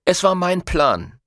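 gruffly assertive; brusque retort. A narrator's reading. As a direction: shut down the complaint curtly. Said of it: "entgegnete Mercurio barsch".

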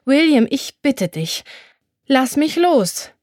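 pleading with rising alarm; urgent pleading. A narrator's reading. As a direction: beg him to let go, voice tightening. Pell begs him to release her.

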